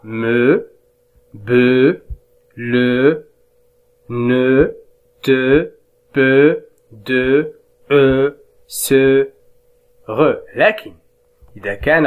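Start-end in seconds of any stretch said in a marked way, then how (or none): 0.0-10.3 "لو" "نو" "تو" spaced and even, slowing at the end; reading drill. Shown as a demonstration. Teacher rereading syllables for the class. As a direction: read the syllables again slowly. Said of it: "إذن أعيد القراءة".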